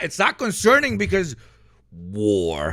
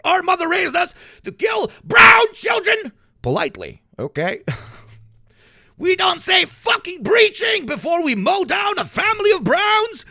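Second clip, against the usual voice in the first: higher, shouty hammed-up preacher; mock pious patriot; 12 semitones higher, 3 dB louder than usual. Same mock patriot goes on.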